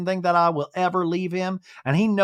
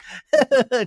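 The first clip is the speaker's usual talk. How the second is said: a quick higher mock giggle; imitated giggle; 5 semitones higher, 4 dB louder than usual. Imitating Brittany's giggle after apologizing to everyone. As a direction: giggle like her. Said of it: "She does that giggle".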